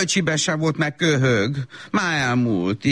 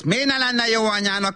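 ordinary talk, nothing marked

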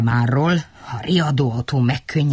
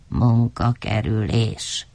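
ordinary delivery

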